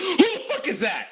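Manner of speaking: sudden high startled yell; startled shout. Retold or imitated. [name] startled by his own reflection, high shocked yell. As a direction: jump back in shock at the stranger in the mirror. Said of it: "he turns and is like".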